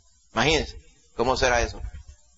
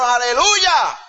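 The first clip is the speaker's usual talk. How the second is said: loud, high shout of praise; exultant hallelujah; 10 semitones higher, 6 dB louder, much slower than usual. Acted. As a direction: cry it out like the heavenly crowd. Heavenly crowd's praise voiced. (aleluya gritado con júbilo)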